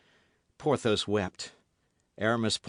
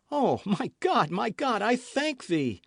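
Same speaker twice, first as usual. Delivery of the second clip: higher relieved prayer; grateful cry; 10 semitones higher than usual. A narrator's reading. D'Artagnan thanking God she revives, relieved. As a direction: thank heaven fervently.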